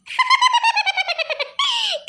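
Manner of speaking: shrill gleeful cackle; loud high cackle. Acted. Rich partying girl, loud gleeful cackle. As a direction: burst into a high, loud laugh. Wild laugh after song.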